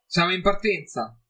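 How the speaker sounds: annuncio di sfida, più acuto e forte; defiant. A narrator's reading. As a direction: sfida Felix ad obiettare. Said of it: "annunciò Elissa con tono di sfida". (tono di sfida, acuto)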